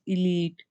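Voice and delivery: elite said as written, ee-LEET; pronounced as spelled. Shown as a demonstration. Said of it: "the same way that we will pronounce it".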